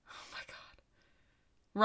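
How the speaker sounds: breathy whispered aside, slow. Real, not acted. Host whispering aside to co-host mid-reading, slow whisper. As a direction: drop to a whisper under your breath.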